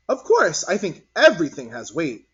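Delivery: put-on a know-it-all little girl; confident child. A narrator's reading.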